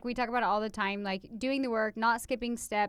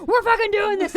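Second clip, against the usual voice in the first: high, loud, bossy declaration; forceful command; 8 semitones higher, 8 dB louder than usual. Retold or imitated. Imitating cohost's blunt approach, loud and bossy. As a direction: bulldoze straight into the plan. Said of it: "you approach things like".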